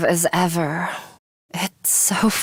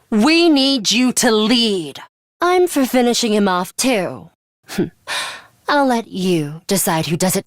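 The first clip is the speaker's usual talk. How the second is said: usual speaking voice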